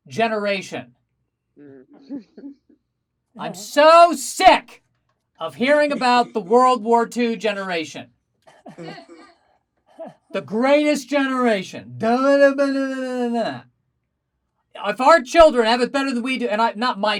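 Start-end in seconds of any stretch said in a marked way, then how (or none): 3.4-11.9 fed up, spitting it out; exasperated rant. Acted. Comic fed up with hearing about the older generation, loud. 12.0-13.6 mocking da da. Mocking the endless boasting.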